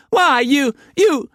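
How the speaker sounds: very high, spluttering landlady; outraged madam. A narrator's reading. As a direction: sputter with fury.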